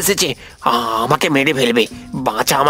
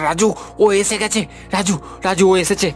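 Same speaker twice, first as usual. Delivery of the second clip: high-pitched, panicky warning; frightened, raised-pitch alarm; terrified warning; 4 semitones higher than usual. Acted. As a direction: call to your friend in terror. Terrified man alerts his friend.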